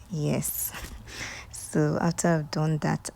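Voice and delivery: normal talking voice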